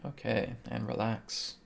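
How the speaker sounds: soft, quiet breathing guide. Acted. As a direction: count the breaths gently and softly. Leads a quiet breathing count.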